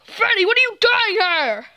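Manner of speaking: ordinary talk, nothing marked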